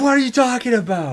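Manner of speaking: high, loud re-enacted squabble; mock yelling. Couple re-enacting their argument over a game, mock yelling. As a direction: yell it in playful exasperation. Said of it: "we don't have to start yelling".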